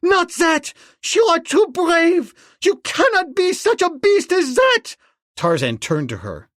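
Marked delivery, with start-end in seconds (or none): 0.0-5.0 high, loud horrified cry. A narrator's reading. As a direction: cry out in horror, pitched high. Girl pleading with Tarzan.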